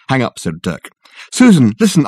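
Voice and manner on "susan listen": higher, louder pleading; urgent plea. Acted. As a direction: beg her to listen.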